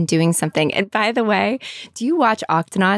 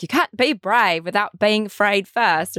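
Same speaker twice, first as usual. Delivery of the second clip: attempted Australian cartoon-captain accent. Host quotes Captain Barnacles from her kids' show, attempted Australian accent. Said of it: "a horrible accent that I'm trying".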